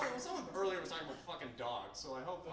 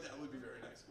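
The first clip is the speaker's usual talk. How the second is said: soft mugger; 4 semitones lower, 8 dB quieter, faster than usual. Retold or imitated. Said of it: "he's like".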